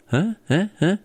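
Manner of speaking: normal talking voice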